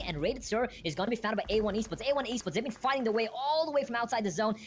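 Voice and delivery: ordinary delivery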